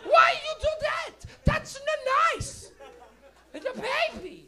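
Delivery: put-on high, loud, angry old lady shouting; scolding old lady. Little Italian lady yelling at the comic, high and loud. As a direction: scold him like an outraged grandma. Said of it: "She goes".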